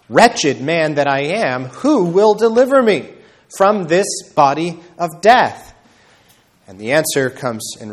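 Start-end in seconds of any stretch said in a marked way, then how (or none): 0.1-5.7 anguished cry, higher and louder. Retold or imitated. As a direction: cry out in despair like Paul. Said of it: "Paul cries out".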